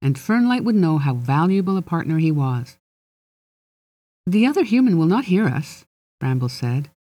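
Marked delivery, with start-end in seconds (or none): 4.2-5.9 fairy voice pushed higher; a touch too high, trying to sound calm; fairy reassuring a human client in a too-high voice. Acted. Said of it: "Now his voice was too high".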